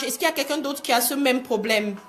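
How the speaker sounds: usual speaking voice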